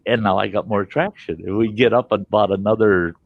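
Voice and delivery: ordinary talk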